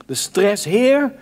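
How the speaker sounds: put-upon and tense, rising into the final question; stressed complaint. Acted. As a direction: act out an indignant, stressed-out appeal. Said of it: "proef je hier de spanning, de stress?".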